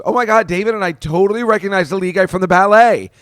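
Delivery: high, loud, gushing rush of excitement; excited gushing. Retold or imitated. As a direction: gush like a starstruck fan. Excited owner gushes about ballet.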